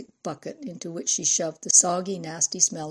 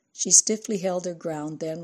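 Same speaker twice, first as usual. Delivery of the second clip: usual speaking voice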